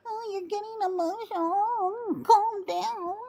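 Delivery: high, sing-song mock of a dismissive man; mocking imitation. Woman mimicking men who dismiss her, mocking high voice. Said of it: "And then you go".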